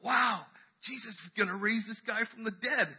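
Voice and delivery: high-pitched amazed onlooker; awed anticipation. Acted. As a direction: marvel like an expectant follower, voice lifted high. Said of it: "the group that was expectant".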